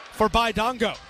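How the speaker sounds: ordinary delivery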